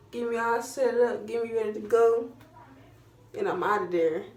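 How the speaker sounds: ordinary talk, nothing marked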